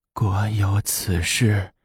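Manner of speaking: slow, soft, feeble voice; frail ailing empress. A narrator's reading. Sick empress opens her eyes to the report, weakly. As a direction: murmur slowly, barely roused from sickbed.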